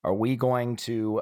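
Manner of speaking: ordinary talk, nothing marked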